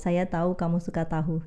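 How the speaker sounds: first "tahu" said as tau, last with h; contrasted pronunciations. Shown as a demonstration. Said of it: "can you notice the difference?".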